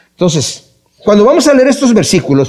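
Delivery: ordinary talk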